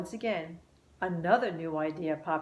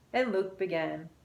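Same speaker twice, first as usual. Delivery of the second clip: plain reading voice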